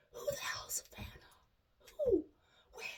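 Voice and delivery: baffled breathy whisper, unvoiced. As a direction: whisper it, stunned and confused. Baffled inner reaction, whispered.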